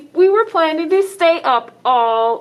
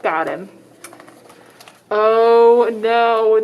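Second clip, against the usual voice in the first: plain reading voice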